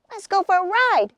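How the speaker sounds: high, loud excited shout. Acted. Pip shouting to Woody as the leaf lifts. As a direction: call out eager for the ride. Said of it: "shouted little Pip".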